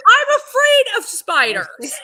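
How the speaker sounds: high, loud, slow wail; hammed-up dread, stretched out; comic panic. Actress alone after bluffing about tarantulas, comic panic. Retold panic, confessing her fear.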